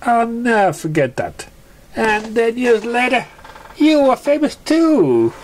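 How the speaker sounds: high pony voice, slowing at the end; a filly remembering with awe; high cartoon pony, admiring; wistful young pony.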